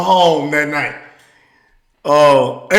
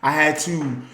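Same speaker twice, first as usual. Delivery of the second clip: usual speaking voice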